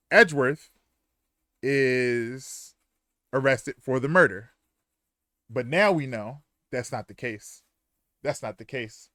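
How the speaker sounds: usual speaking voice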